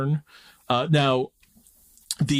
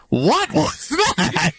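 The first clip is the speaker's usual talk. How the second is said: high, drawn-out mocking screech; mimicked roasting; 14 semitones higher, 3 dB louder, much slower than usual. Friend mimicking her roast of Southern accents, mock appalled. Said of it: "you literally say to me".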